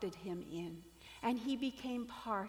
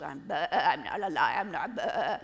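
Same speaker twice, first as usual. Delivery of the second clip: loud, low bleats breaking up the denial; bleating sheep lion; 6 semitones lower, 9 dB louder than usual. Acted. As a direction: deny it in a sheep's baa. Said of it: "And the sheep".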